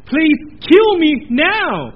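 loud, high, slow plea; anguished cry. Acted. Preacher voicing Isaiah before God, loud anguished plea. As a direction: beg to die in despair.

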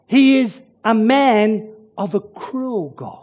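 normal talking voice

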